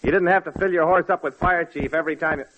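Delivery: ordinary delivery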